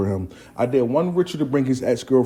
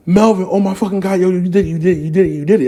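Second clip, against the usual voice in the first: raised, rapid-fire amazed repetition; excited friend; 6 semitones higher, faster than usual. Retold or imitated. Friend calling after winning in court, excited. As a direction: imitate him bursting with good news.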